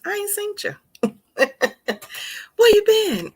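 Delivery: high, twangy down-home country greeting. Pretend. Livestream host greeting her family in a country voice. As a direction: call out like a country auntie. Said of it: "in my country voice".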